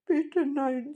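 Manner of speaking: high, anxious plea; pleading grandmother. A narrator's reading. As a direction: beg in a high voice.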